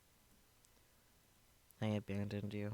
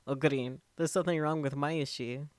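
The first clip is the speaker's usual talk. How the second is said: loud, higher alarmed call; 6 semitones higher, 10 dB louder than usual. Acted. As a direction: urgently call out to a friend. Panicked discovery of Mayuri.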